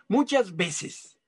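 normal talking voice

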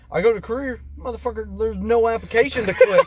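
worked-up complaint about the job site; exasperated rant. Retold or imitated. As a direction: vent the frustration up high. Exasperated retelling of job search.

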